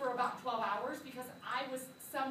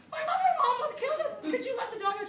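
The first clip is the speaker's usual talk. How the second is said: much higher and fast, laughing; frantic laughing plea; 10 semitones higher, 5 dB louder, faster than usual. Retold or imitated. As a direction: pour it out in a breathless rush.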